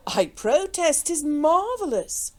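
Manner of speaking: drawn-out gentlemanly amazement; imperturbable. A narrator's reading. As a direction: marvel lazily, wholly unruffled. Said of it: "continued Sir Percy imperturbably".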